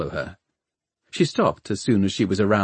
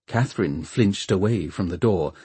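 usual speaking voice

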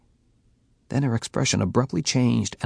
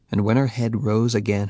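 ordinary delivery, nothing marked

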